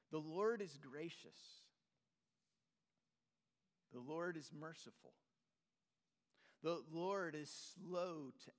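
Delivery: unhurried scripture reading with long pauses between lines; read slowly. Real, not acted. Preacher reading the psalm slowly to his congregation, unhurried. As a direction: let each line of the psalm land on its own before the next. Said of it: "I want to read this slowly".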